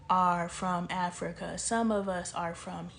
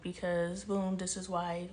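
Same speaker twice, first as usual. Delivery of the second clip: ordinary talk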